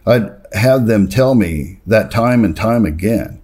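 ordinary talk, nothing marked

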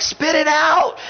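high, pleading spit it out; a fed-up husband to his wife; exasperated king. Retold or imitated. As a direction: urge her on, half joking. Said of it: "It's like".

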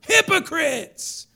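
high, loud, stretched-out shout; exclaimed rebuke. Retold or imitated. Preacher repeating Jesus' rebuke from scripture, exclaimed. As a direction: thunder the word out slowly. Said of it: "That's how he said it right there".

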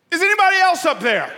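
high, fast, loud call upward; yelled punchline. Acted. Man on the branch seeks other help, yelling. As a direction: shout past God for a second opinion.

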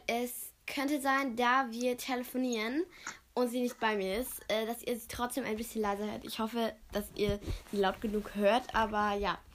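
ordinary delivery

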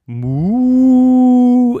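very loud, high, long moo; huge drawn-out cow call; magic moo. Acted. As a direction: belt out a spell-casting moo.

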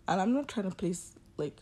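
ordinary delivery

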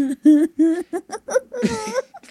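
high, breathless laughing fit; helpless laugh. Real, not acted. Cracking up at her own airplane rant, high breathless laugh. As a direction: collapse into real laughter.

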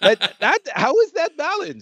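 high, laughing disbelief; can't believe how broken it is; incredulous. Real, not acted. Outraged at Roadhog balance.

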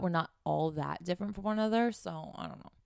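ordinary delivery

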